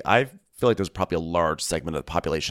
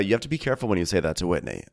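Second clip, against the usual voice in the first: ordinary delivery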